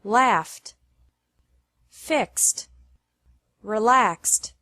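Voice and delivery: "Laughed, fixed, relaxed" with a final T, no extra syllable. Shown as a demonstration. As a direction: finish each word on a light T. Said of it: "E D is pronounced like T".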